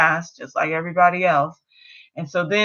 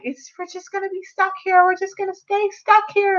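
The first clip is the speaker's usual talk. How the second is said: much higher, childlike mock whine; whiny little boy; 13 semitones higher than usual. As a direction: play a hopeless little kid, pitched way up. Little boy despairs in grass.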